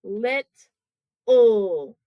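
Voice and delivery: "lit, ul" as two separate beats; teacher splitting the word in two; syllable by syllable. Shown as a demonstration. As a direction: pause between "lit" and "ul". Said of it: "It's the second syllable".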